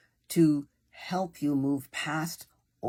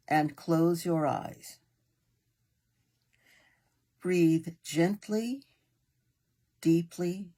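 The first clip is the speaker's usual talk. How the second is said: unhurried meditation guide with long pauses; guided meditation. Acted. Meditation leader easing a listener into breathing and relaxation, guided meditation voice. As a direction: guide them gently, phrase by phrase, leaving room to breathe.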